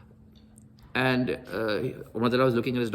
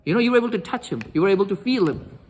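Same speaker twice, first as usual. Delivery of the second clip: higher, impassioned rebuke; retold fury; 6 semitones higher than usual. Asma to Umar about access to the Prophet, acted indignation. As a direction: imitate an infuriated woman making her case. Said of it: "She's infuriated".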